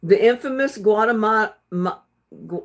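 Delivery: botched Guatemala, breaking off into laughter; tongue-twisted. Real, not acted. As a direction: stumble on Guatemala and break into fragments. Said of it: "I can't even say it".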